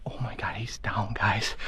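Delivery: thrilled, breathless whisper; hushed hunter's excitement; excited whisper. Real, not acted. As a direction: whisper the good news, barely containing joy. Hunter just shot a buck.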